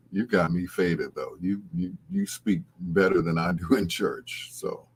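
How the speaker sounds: ordinary talk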